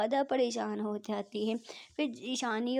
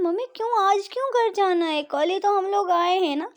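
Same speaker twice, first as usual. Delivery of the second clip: upset protest; 5 semitones higher, 6 dB louder than usual. Acted. Daughter protests leaving.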